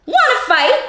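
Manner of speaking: high, squeaky challenge; grouchy ladybug. Acted. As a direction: pick a fight in a tiny voice.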